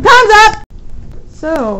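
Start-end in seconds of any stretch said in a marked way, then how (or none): 0.0-0.6 loud time's-up call; shouting. Real, not acted. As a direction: call it out, higher and louder. Calling time in challenge.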